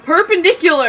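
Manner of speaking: loud, high battle cry; yell. Acted. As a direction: shout it as you throw. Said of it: "Don picked him up and yelled".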